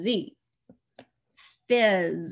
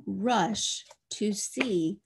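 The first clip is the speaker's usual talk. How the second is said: words stretched out very slowly; very slow dictation; much slower than usual. Shown as a demonstration. Teacher dictating very slowly to a child writing. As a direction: read it as slowly as a child writes. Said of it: "Did Josh rush".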